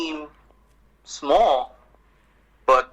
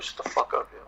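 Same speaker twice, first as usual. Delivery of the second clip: mock mutter under the breath, barely voiced; muttered comeback; 8 dB quieter than usual. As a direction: hiss it as a whispered aside, half joking. Retold comeback to a doubter.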